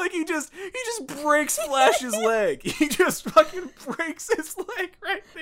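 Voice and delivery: high, slow, giggly telling; cracking up at the absurd fight; laughing. Real, not acted. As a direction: talk through the laughter, way up high. Laughing while retelling the fight.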